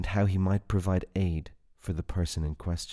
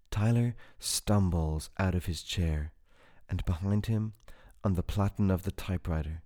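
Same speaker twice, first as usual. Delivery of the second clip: ordinary delivery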